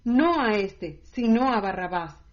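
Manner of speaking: loud shouted crowd line; the crowd shouts back at Pilate, loud; crowd shouting. A narrator's reading. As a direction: yell it as the crowd demanding Barabbas. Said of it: "Gritaron entonces otra vez".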